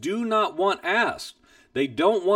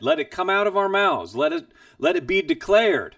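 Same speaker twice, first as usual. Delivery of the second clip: ordinary talk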